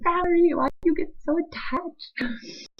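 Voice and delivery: high, slow, loud mock rebuke to herself; self-scolding.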